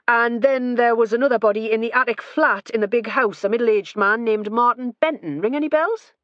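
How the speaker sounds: pressing with a pointed question; brisk questioning. A narrator's reading.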